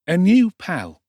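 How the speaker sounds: high, loud breezy street lad; cocky Dodger. A narrator's reading. Dodger introducing Oliver to the doorman, cocky. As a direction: toss it off cheekily. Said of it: "replied Jack Dawkins".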